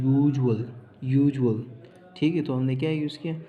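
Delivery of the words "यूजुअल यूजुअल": "यूजुअल" louder, opening on a clear "y" sound, falling; y-sound demo. Shown as a demonstration. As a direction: sound out the y at the start of "यूजुअल" for the class. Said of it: "वाई की आवाज़ दे रहे हैं".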